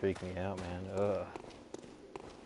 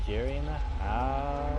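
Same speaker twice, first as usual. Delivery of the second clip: high, loud, slow hype call; hype announcer voice; 6 semitones higher, 6 dB louder, slower than usual. Pretend. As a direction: announce him like a hype man. Hyping up the enemy.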